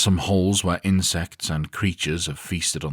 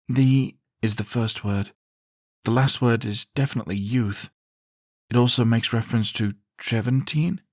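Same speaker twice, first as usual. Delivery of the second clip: careful reading; 7 semitones higher than usual. A narrator's reading. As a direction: read out carefully as Zarina. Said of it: "checking her reading".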